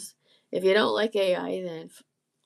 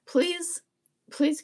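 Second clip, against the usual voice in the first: pleading please; 8 semitones higher than usual. Real, not acted. Pleading for subscribers.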